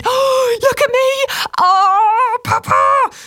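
very high, joyful squealing; high-pitched, thrilled child crying out; excited child's shout. A narrator's reading. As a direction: shout to your father like an overjoyed little girl. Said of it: "she shouted".

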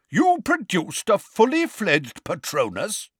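high, booming interrogation. A narrator's reading. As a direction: boom it out in astonished inquiry. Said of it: "in a booming voice".

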